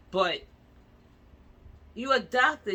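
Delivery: shouted high and loud, accusing; furious outburst at the doctors; outraged shout. Real, not acted. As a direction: throw the accusation at them loudly.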